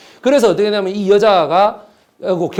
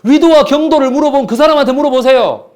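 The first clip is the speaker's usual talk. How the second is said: much higher and louder; indignant outburst; 9 semitones higher, 7 dB louder than usual. Real, not acted. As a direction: fire the challenge at the class.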